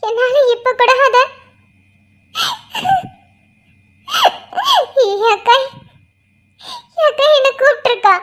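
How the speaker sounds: sobbing, voice rising and cracking, speeding up at the end; breaking down crying. Acted. Grieving sister pours out her loss to a friend, sobbing. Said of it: "அழாத".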